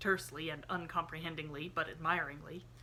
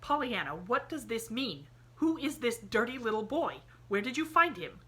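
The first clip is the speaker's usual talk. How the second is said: a sharp, stern aunt demanding answers; 6 semitones higher, 6 dB louder than usual. A narrator's reading. Aunt Polly demanding to know who the boy is, sharp. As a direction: insist on an answer. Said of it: "she demanded sharply".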